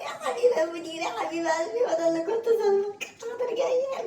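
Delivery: high sugary fake affection; mock sweetness. Employee to boss, high sugary mock affection. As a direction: gush at the boss sarcastically.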